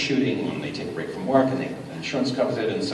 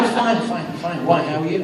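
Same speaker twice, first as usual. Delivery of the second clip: higher, over-eager mock reassurance; feigned nonchalance; 7 semitones higher, 3 dB louder than usual. Actor hiding an injury from his director, feigned nonchalance. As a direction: cover up too brightly, pitched up.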